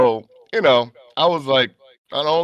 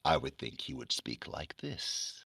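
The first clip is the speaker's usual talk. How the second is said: low, hushed, slow character voice; Sandman; 9 semitones lower, 15 dB quieter than usual. Shown as a demonstration. Comic fan voicing his favourite character, Sandman voice. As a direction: speak very softly and low, drawing it out. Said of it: "so I would do his voice".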